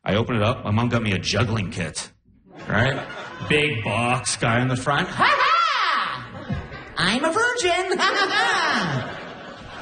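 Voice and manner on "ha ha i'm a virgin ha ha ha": very high, hammed-up cackling cheer; goofy box-art guy. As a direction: crow it high with a slow, mock laugh. Voices guy on juggling kit box.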